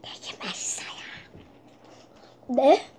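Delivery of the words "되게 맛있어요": ASMR whisper. Shown as a demonstration. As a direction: whisper it softly. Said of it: "에이에스엠알은 조그맣게 하는 거지".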